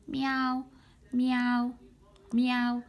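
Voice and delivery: high sweet meows; cat meow. Shown as a demonstration. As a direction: meow like a kitten. Cat sound for kids.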